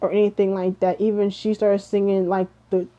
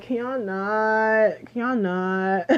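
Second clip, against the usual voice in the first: exasperated; 2 semitones higher, slower than usual. Retold or imitated. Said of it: "I'm just like".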